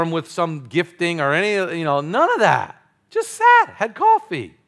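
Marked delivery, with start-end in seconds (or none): 3.1-3.7 playful emphasis. Shown as a demonstration. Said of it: "You like the way I said it?". 3.7-4.5 playful emphasis. Shown as a demonstration. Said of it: "You like the way I said it?".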